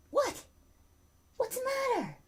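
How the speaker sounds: very high, soft cooing voice; pet talk. Real, not acted. Owner asks fussing dog what's wrong, high pet talk. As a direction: coo high and softly to the dog.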